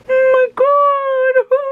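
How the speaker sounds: very high, slow gasp; stunned, stretching it out; high shocked squeal with laugh. Real, not acted. Seeing nose without piercings.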